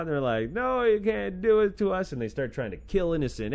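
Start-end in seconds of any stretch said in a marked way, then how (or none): 0.5-2.1 hammed-up wail, pitched higher; mock wailing plea. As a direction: pretend to sob and beg the angel. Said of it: "everybody starts bawling their eyes out".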